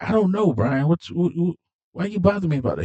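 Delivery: high-pitched mock irritation; acted exasperated denial. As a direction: brush off his questions, voice shooting up. Acted quarrel, Mia snapping back.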